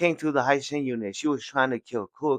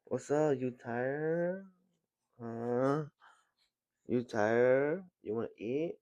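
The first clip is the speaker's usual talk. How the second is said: 아이에게 속삭이듯 아주 부드럽게 말하는 아빠; 다정한 아빠 목소리로 낮고 조용하게; soft fatherly voice; 2 semitones higher, 8 dB quieter than usual. Acted. Father gently talks to child.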